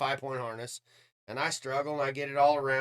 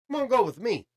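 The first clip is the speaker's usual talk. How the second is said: eager invitation; 9 semitones higher, 5 dB louder than usual. Retold or imitated. Driver invites injured friend along.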